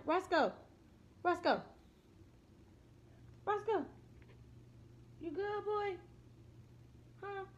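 soft pet voice. Real, not acted. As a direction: gently check on the dog. Softly calling dog.

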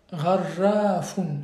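Gharrafun drawn out slowly, the r strongly rolled. Shown as a demonstration. Teacher corrects a student's reading, slow model with a rolled r. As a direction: stretch Gharrafun out and trill the r. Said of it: "C'est un R roulé".

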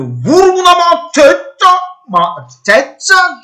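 high, slow, drawn-out yell of hype; will the striker to shoot, stretching every word; excited shout. Real, not acted. Manager at the screen urging Mateta to shoot, drawn-out excited yell.

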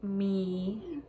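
slow, stretched-out reading; word by word, unhurried; dictating while typing. Real, not acted. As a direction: draw each word out as you type it. Typing the next line aloud.

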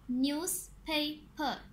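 "Newspaper" slowly in three beats; "newspaper" broken into three parts; syllable by syllable. Shown as a demonstration. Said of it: "इनके three syllables हैं".

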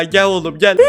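ordinary talk, nothing marked

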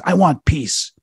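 high, emphatic demon's confession; retold outburst. Demon finally answering the exorcist, retold outburst.

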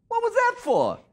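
very high mock protest; hammed-up squeaky complaint; indignant sidekick. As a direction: imitate a wounded comic stooge. [name] protests the smack.

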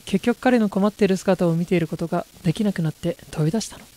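ordinary delivery

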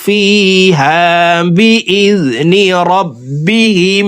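slow, high, melodic tajweed chant; drawn-out reverent recitation; Quran recitation. Acted. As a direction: draw out each verse like a reciter.

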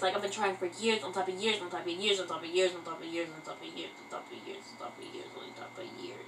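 quick chant fading to a whisper; trailing repetition. Real, not acted. Vlogger exaggerating years of singing practice, fading quick repetition.

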